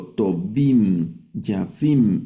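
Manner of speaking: "Tovim, yafim" each landing firmly on -im; final -im sound. Shown as a demonstration. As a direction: punch the last syllable in "Tovim, yafim". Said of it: "Ese sonido im al final". ("Tovim, yafim" recalcando el im final)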